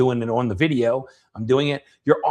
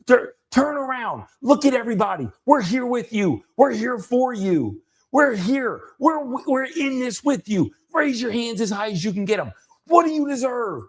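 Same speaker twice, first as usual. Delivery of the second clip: high-pitched fervent coaching; rally someone with the whole group behind them; retold motivational call, pitched way up; intense, assertive; 9 semitones higher than usual. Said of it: "way more assertive than she was".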